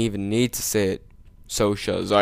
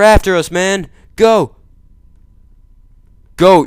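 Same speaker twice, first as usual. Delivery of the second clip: loud, much higher urgent shouts; frantic yelling; 8 semitones higher, 7 dB louder than usual. Real, not acted. Chased in the game, yells at the driver to go. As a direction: yell at him to drive, panicking.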